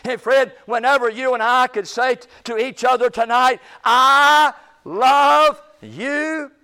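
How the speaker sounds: loud, high, fervent preaching cry; full-force preaching. Acted. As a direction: preach it out to the whole church. Preacher building to full-force climax.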